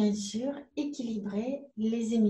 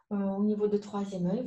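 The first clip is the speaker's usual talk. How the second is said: usual speaking voice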